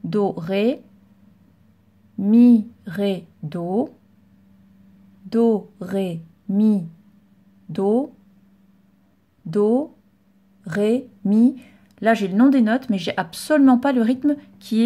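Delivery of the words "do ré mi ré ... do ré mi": uneven rhythm. Shown as a demonstration. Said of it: "ce qu'il ne faut pas faire".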